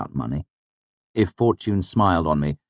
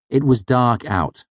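slow, louder, earnest statement; seriously; 8 semitones higher, 6 dB louder than usual. A narrator's reading. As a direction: say it plainly, not joking. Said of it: "I said seriously".

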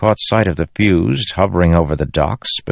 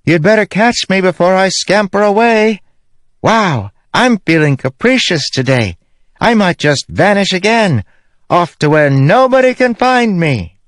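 high-pitched, taunting call; Syl shouting up; 3 dB louder than usual. A narrator's reading. Syl dares the spren to catch her, capricious shout. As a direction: tease them in a high, carrying shout. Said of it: "she shouted back".

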